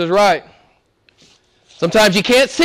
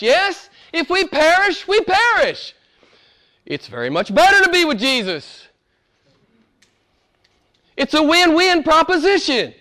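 exultant preaching; 8 semitones higher than usual. Acted. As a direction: lift the room with triumph. High exultant preaching run.

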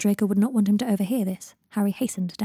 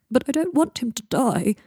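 higher, pleading acted upset; acted tearful outburst, pitched up; starting to cry; 5 semitones higher, 4 dB louder than usual. A narrator's reading. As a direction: plead as if about to sob. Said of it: "starting to cry".

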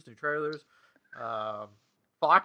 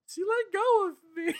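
put-on squeaky, high kid voice, quoting her son; high little boy voice. Mom quoting her son from the pool in a squeaky high kid voice. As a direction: pitch way up like a small scared boy. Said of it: "And he was like".

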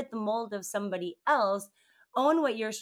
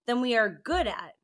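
ordinary talk, nothing marked

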